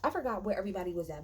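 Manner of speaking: ordinary talk, nothing marked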